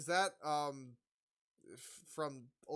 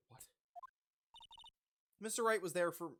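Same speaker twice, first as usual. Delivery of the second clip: ordinary talk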